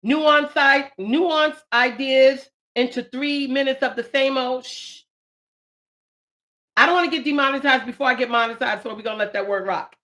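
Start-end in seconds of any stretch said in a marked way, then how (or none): 4.6-5.0 a quick hushed shh; soft self-shush. Real, not acted. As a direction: hush yourself mid-sentence. Hushing herself after a word.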